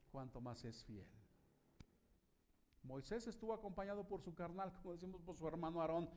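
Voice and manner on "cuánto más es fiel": soft echo. Shown as a demonstration. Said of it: "echo said softly".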